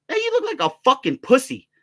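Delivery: high-pitched jeering taunt; retold taunt. Storyteller taunts the man outside, high retold jeer. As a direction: jeer at him, voice pitched well up. Retells his insult to the man.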